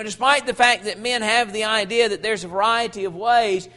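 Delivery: ordinary talk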